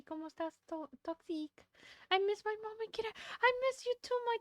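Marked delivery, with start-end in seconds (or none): 2.1-4.4 high cutesy voice. Pretend.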